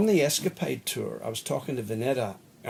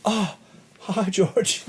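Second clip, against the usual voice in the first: higher, louder, nervously bright hello; flustered greeting; 8 semitones higher, 7 dB louder, much slower than usual. Retold or imitated. Greeting [name] face to face, flustered and excited. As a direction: blurt the hello, a bit overwhelmed.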